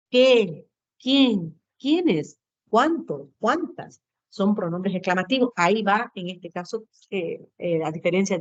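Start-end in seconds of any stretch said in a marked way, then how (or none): 0.1-4.0 each word exclaimed on its own with a falling end; exclamatory tone. Shown as a demonstration. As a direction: hit "Qué", "Quién", "Cuántas" high, then drop. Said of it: "esos pronombres exclamativos". (palabra por palabra, en tono exclamativo)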